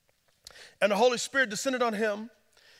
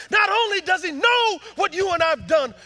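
"not only does he know" shouted even louder, rising on "know"; preaching shout; 12 semitones higher, 8 dB louder than usual. Acted. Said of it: "repeated line, second louder".

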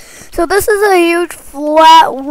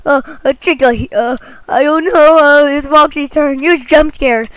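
ordinary talk

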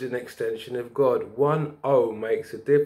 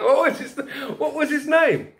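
laughing; 13 semitones higher, 4 dB louder than usual. Real, not acted. Bursts out laughing remembering name.

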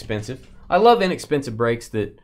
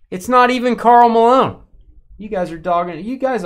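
triumphant; 11 semitones higher, 8 dB louder than usual. Real, not acted. Mocking wrong guessers.

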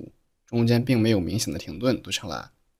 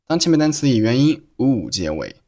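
ordinary talk

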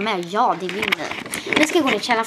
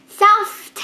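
put-on high, loud kid's demand; shrill toddler's cry for saft; excited child; 11 semitones higher, 7 dB louder than usual. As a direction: squeal it like an eager little sister. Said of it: "Och Ida bara".

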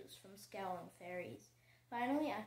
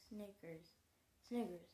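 usual speaking voice

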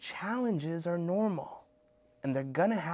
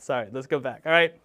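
ordinary delivery

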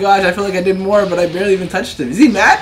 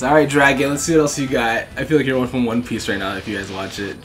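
normal talking voice

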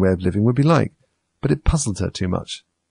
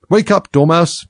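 high, loud wake-up call; crying out; 9 semitones higher, 7 dB louder, slower than usual. A narrator's reading. Hatter and March Hare waking the Dormouse, loudly. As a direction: call sharply to rouse a sleeper. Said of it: "they both cried".

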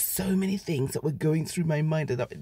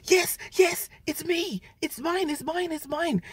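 much higher, eager yes yes; excited; 12 semitones higher, slower than usual. Retold or imitated. Claiming the passport from the officer, excited and high. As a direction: jump at it, relieved and thrilled. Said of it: "so excited".

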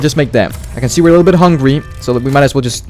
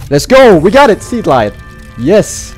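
excited cheer; 6 semitones higher, 5 dB louder, slower than usual. Real, not acted. Collects the scanner part.

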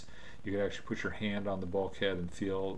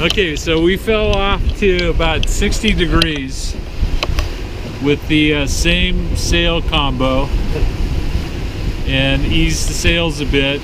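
shouting over wind; 8 semitones higher, 18 dB louder than usual. Real, not acted. Talking on deck in wind.

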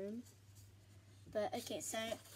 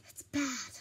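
whispered aside; 5 dB louder than usual. Real, not acted. Aside after the warning.

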